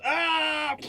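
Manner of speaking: high-pitched mock scream; high death cry. Crushed elf under the falling tower, high mock scream. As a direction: cry out as you get crushed. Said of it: "and he goes".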